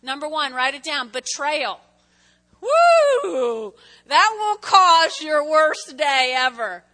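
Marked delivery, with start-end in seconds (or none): 2.6-3.7 loud high whoop; excited whoop. Real, not acted. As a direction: let out a big preacher's woo. Preacher whoops after betrayal.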